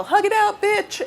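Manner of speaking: high-pitched imitation of a street heckler; mock yell. Interviewer imitating fans who yell at him on the street, mock yell. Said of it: "people yell".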